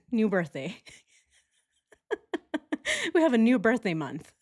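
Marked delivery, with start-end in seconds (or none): 2.1-3.1 high squealing laugh. Real, not acted. Laughing at new birthday joke.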